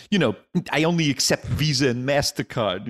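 comic freezing in front of the booker as Woody Allen. Retold or imitated. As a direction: stammer it like a panicky Woody Allen. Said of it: "just turned into Woody Allen".

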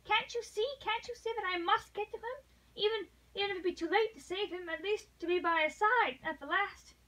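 high, urgent, desperate insistence; almost savage energy. A narrator's reading. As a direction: plead fiercely to be allowed to go. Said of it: "almost savage en-, energy".

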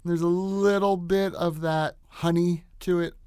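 ordinary delivery

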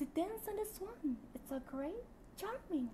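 high, slow, sweet game heroine voice; Odet voice. Acted. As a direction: purr it like a graceful anime heroine. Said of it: "Aku bisa suara Odet Mobile Legend". (suara Odet yang tinggi dan manis)

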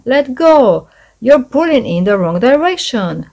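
loud, high shout; shouting across the boat. A narrator's reading. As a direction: shout at the goblin who pulls wrong. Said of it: "shouted the third goblin".

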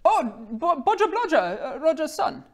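very high, loud recognition; excited young Goliath woman. Acted. Young woman recognises Roger's son, high and excited.

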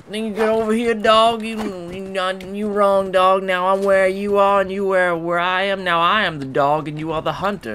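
mock hunter's rant. Ranting in character at a dog.

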